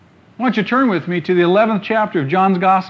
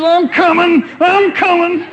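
higher, louder, hurried comic calling out; mock eager Lazarus; 12 semitones higher, 8 dB louder, slower than usual. Lazarus shuffling out of the tomb, high and loud. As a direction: shuffle out yelling you're on your way.

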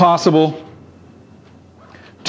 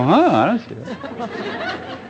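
slow, baffled mock reply, voice pitched up; puzzled friend; 6 semitones higher, 6 dB quieter, slower than usual. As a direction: play the confused buddy who sees nothing. Friend who sees nothing.

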